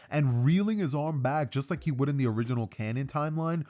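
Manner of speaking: ordinary delivery, nothing marked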